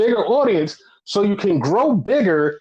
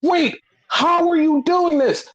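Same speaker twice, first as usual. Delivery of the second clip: baffled; 8 semitones higher than usual. Retold or imitated. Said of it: "And I was like".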